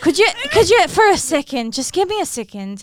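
lively Irish lilt; Irish accent. Shown as a demonstration. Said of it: "do a little bit Irish".

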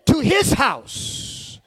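high, loud, stretched-out preaching cry; preaching at full force. Acted. Preacher crying out to the church to dwell with God, soaring whoop. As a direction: whoop it out to the congregation, slow and soaring.

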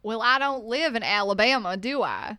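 mock southern US accent, loud. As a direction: put on a hammed-up southern accent. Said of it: "your southern accent is trash".

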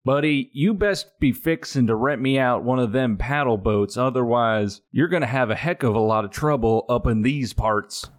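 quick hammed-up country accent; put-on Southern accent. Customer pressing the boatman for a rental, fast put-on Southern accent. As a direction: threaten in a folksy Southern accent. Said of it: "do that accent".

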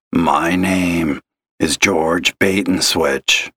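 slow gravelly voice; a smoker's gravelly drawl on the phone; gravelly old caller. Acted. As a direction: drag it out like a heavy smoker. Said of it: "How else do you get a gravelly voice like that?".

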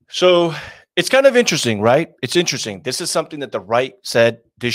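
loud, high, excited burst. Real, not acted. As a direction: jump in loudly and high. Sudden burst after self-teasing.

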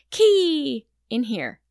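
put-on loud, slow toddler voice; child voice. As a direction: call it out like a proud toddler. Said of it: "Then he said".

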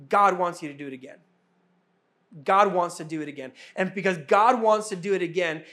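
normal talking voice

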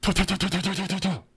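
quick clicked twangs of arrows flying; arrow sound effect. Retold or imitated. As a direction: fire off arrows with your mouth. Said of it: "'cause it's his arrow".

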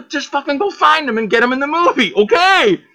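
high, pushy mock demand; mock impatient order. Director ordering his assistant to fetch the car owner, mock impatient. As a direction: bark it at your assistant, half joking.

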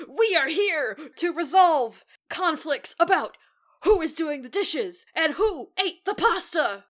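loud, slow superhero proclamation; heroic announcement. Retold or imitated. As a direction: declare it like a posing hero. Kids' mock heroic announcement.

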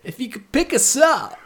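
high, sugary put-on voice; mock high polite voice. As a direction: pitch it way up, overly polite. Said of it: "No, I said".

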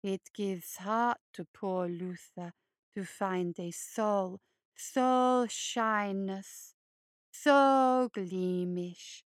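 low, slow, creepy hare voice; low creeping monster croon; hulking hare creature. Acted. Luther the hare speaks.

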